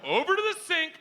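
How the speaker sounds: march them to the sink; drill-sergeant order. Retold or imitated. Dad ordering daughters to wash, bossy command.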